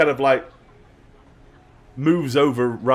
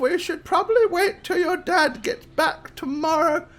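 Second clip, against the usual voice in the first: put-on high-pitched, doubtful old lady; hesitant grandmother. Grandma putting off her granddaughter until dad returns, hesitant. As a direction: stall the child gently but firmly.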